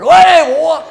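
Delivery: a father jumping from a bush to yell at a bully; startling shout. Retold or imitated. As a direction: leap out and bellow at the boy.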